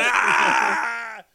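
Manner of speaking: long, high, loud "aah"; shrieking mock laugh outburst; imitated laughing scream. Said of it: "And he starts laughing".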